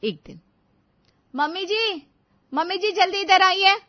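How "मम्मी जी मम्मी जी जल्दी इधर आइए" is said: high, loud urgent call. Acted. Bride shouting for her mother-in-law to come to the kitchen, urgent.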